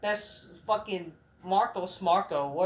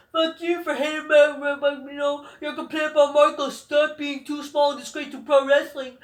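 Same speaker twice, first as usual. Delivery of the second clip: high, loud whiny mock voice, much higher than his own; mocking whiny wrestler; 7 semitones higher, 6 dB louder than usual. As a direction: sneer it like a preachy veteran scolding fans. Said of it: "They go".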